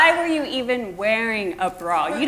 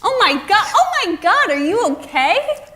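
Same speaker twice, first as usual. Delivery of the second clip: shocked alarm; 9 semitones higher, 5 dB louder than usual. Acted. Shocked at sight.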